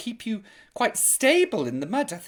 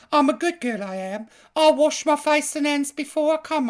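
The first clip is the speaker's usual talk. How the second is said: Eliza Doolittle Cockney; 7 semitones higher, 4 dB louder than usual. Retold or imitated. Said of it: "do you remember that reference from".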